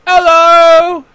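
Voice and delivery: very high, loud cheery hello; chipper hello. Acted. Chipper woman greeting the guards, very high and loud. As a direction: sing out a bright greeting. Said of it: "she's very, like, chipper".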